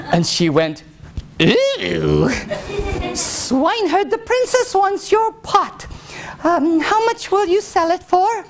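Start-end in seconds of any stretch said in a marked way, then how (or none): 1.4-8.4 very high, squeamish lady voice; disgusted lady in waiting. Acted. Lady in waiting asking the swineherd for his pot, high and squeamish.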